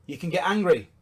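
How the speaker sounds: ordinary talk, nothing marked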